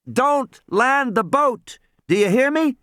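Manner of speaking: put-on insistent high-pitched young caller; urgent radio warning. A narrator's reading. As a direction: space the words out so a faint radio gets them. Boy warns ship over radio.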